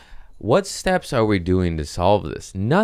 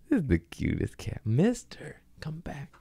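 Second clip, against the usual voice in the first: soft whispered coaxing, pitched up; whisper to cat; 9 semitones higher, 6 dB quieter than usual. Real, not acted. Calling the cat back, whispered high coaxing.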